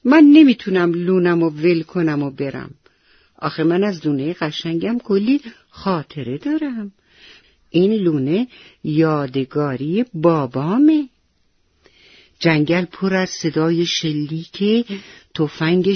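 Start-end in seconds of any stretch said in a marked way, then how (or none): none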